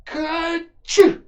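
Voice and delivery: high, loud acted sneeze; a sudden explosive sneeze. Rabbit hiding in the watering can, sneezing loudly. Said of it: "Presently Peter sneezed".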